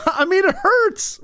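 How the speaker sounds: laughing through the words, pitch up. Real, not acted. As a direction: chuckle while admitting it hurts. Laughing at own cringe memory.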